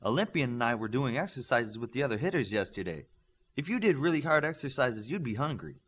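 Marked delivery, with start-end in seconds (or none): none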